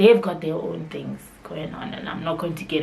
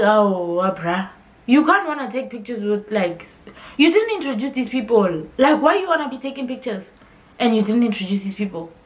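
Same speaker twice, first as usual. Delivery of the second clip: retold scolding; 5 semitones higher, 6 dB louder than usual. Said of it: "And he's like".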